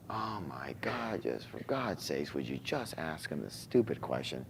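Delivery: hushed, breathless urging to himself; inner voice whisper. Retold or imitated. In the car, urging himself to ask dad, whispered. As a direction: whisper it like the nagging voice in your head. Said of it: "This other part of my voice".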